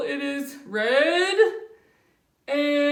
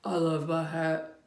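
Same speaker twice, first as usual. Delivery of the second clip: contented low bear; 9 semitones lower, 4 dB quieter than usual. Acted.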